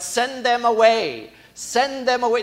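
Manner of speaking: loud dismissal. Acted. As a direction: shout them off, pushing people away. Disciples dismissing the crowd.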